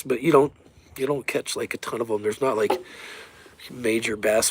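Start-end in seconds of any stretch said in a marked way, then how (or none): none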